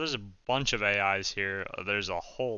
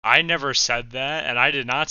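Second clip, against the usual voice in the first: loud protest; 9 dB louder than usual. Real, not acted. Arguing with the AI.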